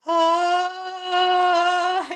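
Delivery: high, thin cartoon wail while driving slowly; Tina's wail. Guest imitating Tina Belcher in the car scene, high cartoon wail. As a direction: squeal like Tina Belcher at the wheel. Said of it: "she's just going".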